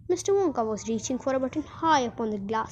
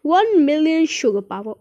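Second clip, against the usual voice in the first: high, loud grand boast; proud proclamation; 5 semitones higher, 9 dB louder than usual. A narrator's reading. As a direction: boast it out at volume. Wonka proudly explains the lift.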